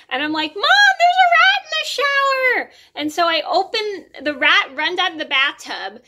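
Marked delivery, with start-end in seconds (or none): 0.5-2.7 high, loud panicked yell for Mom; freaked-out scream. Retold or imitated. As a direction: scream it in a panic, re-enacting the fright. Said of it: "freaking out and screaming".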